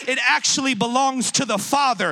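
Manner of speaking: emphatic, insistent sermon delivery; full-force preaching. Real, not acted. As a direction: hammer each declaration home to the congregation.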